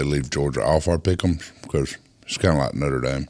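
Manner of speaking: talking around ice; ice in mouth. Real, not acted. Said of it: "Please don't comment if you've got ice in your mouth".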